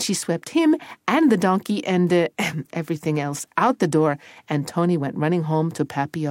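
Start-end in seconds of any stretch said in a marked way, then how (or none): none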